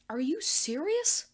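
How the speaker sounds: loud, high, slow disbelief; can't believe it, loud; incredulous. Acted.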